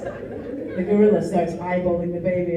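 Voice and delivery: usual speaking voice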